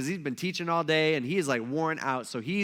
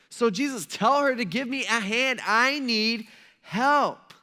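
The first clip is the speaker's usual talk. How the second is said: higher, slow, drawn-out aggrieved nagging; whiny complaint; 5 semitones higher, 4 dB louder, slower than usual. Acted. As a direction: plead like the put-upon sister. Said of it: "She's saying".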